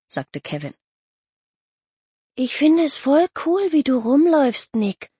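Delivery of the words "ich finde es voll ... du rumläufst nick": high, fawning mock voice of a flatterer; mocking a suck-up. Kevin mocks the class suck-up's voice, high and fawning. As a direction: ham up the teacher's pet, gushing. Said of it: "machte die Stimme des allseits bekannten Schleimers nach".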